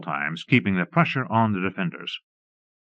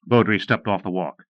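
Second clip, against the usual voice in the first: plain reading voice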